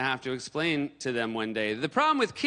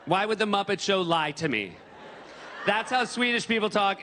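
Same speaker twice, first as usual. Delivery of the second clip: ordinary delivery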